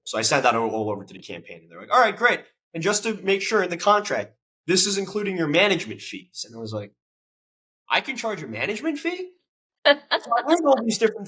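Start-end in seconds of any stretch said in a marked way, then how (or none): 1.9-6.5 high, bright-eyed client voice; lightly voiced client checking the contract; mock campaign staff. 7.9-9.3 high, disbelieving question; incredulous. Retold or imitated. His own disbelief retold.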